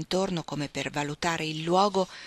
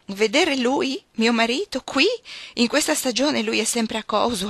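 higher, louder burst of incredulity; amused outburst; 5 semitones higher, 6 dB louder than usual. A narrator's reading. Wife to the man who asked about her husband, amused outburst. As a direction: exclaim it loudly, as if the idea is laughable. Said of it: "poi scoppiò in una risata".

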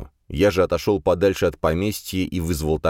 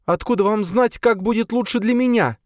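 much higher indignant woman; indignant female voice; 3 dB louder than usual. A narrator's reading. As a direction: voice Sofia indignant, high. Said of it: "возмутилась она".

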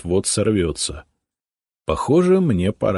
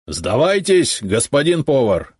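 high, loud call through the door; shout; 7 semitones higher, 4 dB louder than usual. A narrator's reading. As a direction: order him out. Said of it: "крикнул он". (громкий приказ)

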